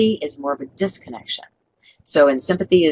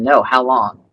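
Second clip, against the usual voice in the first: low, louder sarcastic retort; 4 semitones lower, 5 dB louder, slower than usual. Shown as a demonstration. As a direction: sneer the question back at the caller. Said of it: "That's just pure sarcasm".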